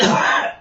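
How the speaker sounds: sharp unvoiced choking cough. Real, not acted. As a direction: cough as if something went down wrong. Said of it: "I was choking out".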